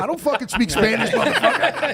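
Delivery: loud, high, half-laughing protest; mock-outraged holler; exasperated protest. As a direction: yell back at the chatter, amused. Acted protest to the lobby.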